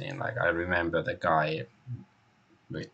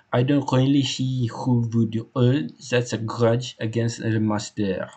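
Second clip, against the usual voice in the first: put-on animated French accent, slightly raised; French-accented loyal servant; 4 semitones higher, 4 dB louder than usual. Hector telling the inspector how he was hired, French-accented. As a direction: play the devoted French employee giving testimony.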